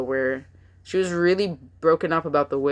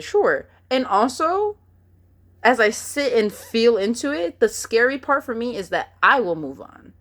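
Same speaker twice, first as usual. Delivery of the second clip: usual speaking voice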